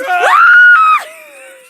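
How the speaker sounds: loud high-pitched startled shriek. Real, not acted. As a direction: let out a sudden piercing shriek. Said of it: "Pardon my shriek".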